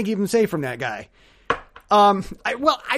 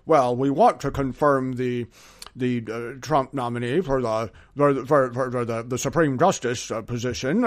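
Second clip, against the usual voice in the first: low, halting politician impression; McConnell; 6 semitones lower than usual. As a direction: play a senator haltingly making excuses in a low voice. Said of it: "for McConnell and the Republicans to say".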